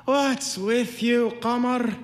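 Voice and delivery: slow, high, loud drawn-out call; yelling from afar. A narrator's reading. Doorman calls up to woman on clothesline, slow high yelling. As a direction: holler it up to someone overhead. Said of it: "he yelled at her from his post".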